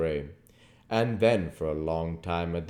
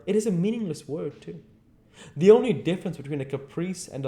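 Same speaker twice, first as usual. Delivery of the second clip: put-on higher, quick, restless young man; young Dorian's voice; 9 semitones higher, 6 dB louder, faster than usual. Dorian musing to Lord Henry about lasting feelings, quick. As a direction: brood aloud over the word always.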